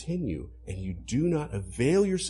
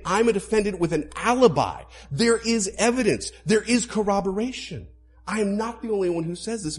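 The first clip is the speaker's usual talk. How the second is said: louder, higher, faster pulpit voice; full-force preaching; 7 semitones higher, 6 dB louder than usual. Acted. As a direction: drive the point home from the pulpit.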